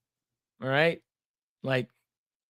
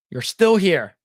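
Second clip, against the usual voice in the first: mock outraged; 3 semitones higher, 6 dB louder, slower than usual. Storyteller calling out the neighbor in mock outrage. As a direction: call it out like catching her red-handed. Repeated punchline.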